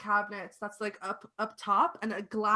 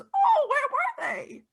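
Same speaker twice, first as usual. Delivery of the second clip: put-on high, slow old lady question; old lady asking; 5 dB louder, slower than usual. Old lady asks Constance where the keys were. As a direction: ask sweetly like a granny. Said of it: "the old lady turns to you and goes".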